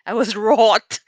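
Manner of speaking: loud, laughing through wroth. Shown as a demonstration. Telling viewers how angry she was, laughing loud. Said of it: "about a woman wrath?".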